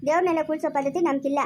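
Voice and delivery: ordinary talk, nothing marked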